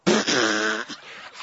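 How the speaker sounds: spluttering mock laugh; imitated stifled laughter. Friend in the corner laughing at him, imitated spluttering laugh. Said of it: "Ian's over in the corner just like".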